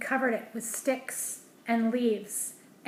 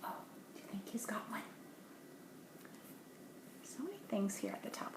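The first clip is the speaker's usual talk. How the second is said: unvoiced whisper, quicker at the end; 15 dB quieter, faster than usual. Real, not acted. Parent wondering aloud to a child, whispering.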